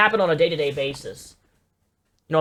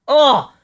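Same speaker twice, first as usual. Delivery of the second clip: disgusted groan; 6 semitones higher, 7 dB louder than usual. Real, not acted. Disgusted outburst at clip.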